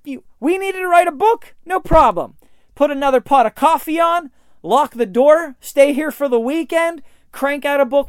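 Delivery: high, loud can-do boast; the boss making a book sound simple; breezy boss confidence. Retold or imitated. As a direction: brush it off like easy work. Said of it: "He said".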